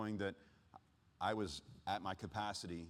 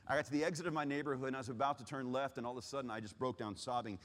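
ordinary delivery

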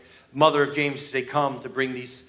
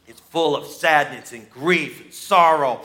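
ordinary talk, nothing marked

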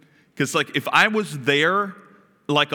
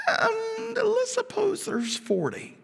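much higher, slow, whimpering plea; whiny pleading Abraham; 4 dB quieter, slower than usual. Acted. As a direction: beg like a timid bargainer. Abraham bargaining down with God.

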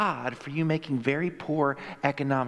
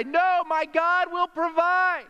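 high, drawn-out defiant holler; hammed-up stubborn cry, stretched out; mock yell; 13 semitones higher, 4 dB louder, slower than usual. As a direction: yell it slowly up to the helicopter. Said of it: "he yelled at the guy".

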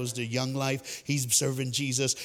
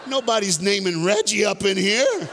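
louder, higher, laughing playful complaint; mock jealous whine; 8 semitones higher, 7 dB louder than usual. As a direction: whine like the kid who never gets picked. Mock jealousy over Preston's namesake.

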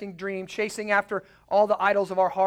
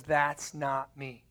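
quoted God, quiet; 5 semitones lower, 6 dB quieter, much slower than usual. Retold or imitated. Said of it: "The God of the Bible says".

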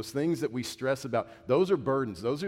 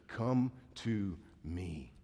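slow authority; 2 semitones lower, 3 dB quieter, much slower than usual. Acted. Most authoritative statement, very slow.

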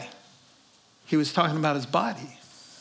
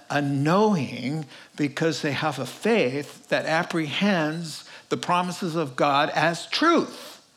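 ordinary talk

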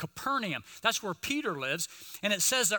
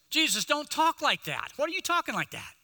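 higher, urgent protest from Peter; a disciple scolding his teacher, voice raised higher; Peter's rebuke; 8 semitones higher than usual. Acted. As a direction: pull him aside and object, pitched up.

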